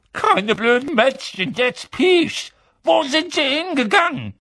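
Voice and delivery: very high, agitated, raspy-tempered outburst; exasperated demand. A narrator's reading. Filch interrogating the stalling Peeves, exasperated. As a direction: lose patience and demand an answer.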